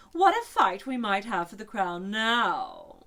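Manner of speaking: loud, slow teasing unicorn; unicorn's sly boast. A narrator's reading. Unicorn eyeing the crown, loud and sly. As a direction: relish the thought.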